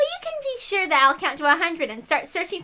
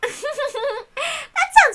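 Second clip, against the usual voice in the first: laughing; 7 semitones higher than usual. Acted. Witch laughing delightedly.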